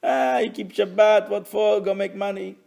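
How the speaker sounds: lightly mock the doubters; mocking scoffer. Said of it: "those who make fun of us".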